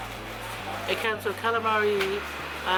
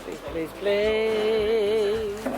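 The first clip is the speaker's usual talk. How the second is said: drawn-out pleading; 4 dB louder, much slower than usual. Real, not acted. Begging to see food.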